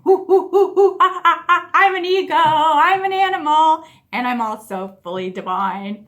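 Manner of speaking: loud, high hoots sliding into a cry of I'm an animal; monkey-like laughing. Shown as a demonstration. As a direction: hoot like a playful monkey, then proclaim. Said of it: "act like a monkey".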